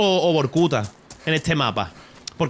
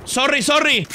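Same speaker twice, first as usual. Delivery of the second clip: very Spanish-accented English; 11 semitones higher, slower than usual. Real, not acted. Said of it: "he dicho sorry con el acento más español de la historia".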